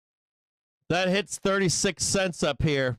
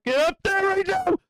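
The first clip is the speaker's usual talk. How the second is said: urging yell; 13 semitones higher than usual. Real, not acted. Yelling at the price chart.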